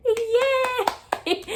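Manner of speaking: high, loud cheer of joy; excited grandma. Retold or imitated. Grandma hears she's getting a great-grandchild, excited high cheer. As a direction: imitate an overjoyed shriek of celebration. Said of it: "She was so excited and so happy".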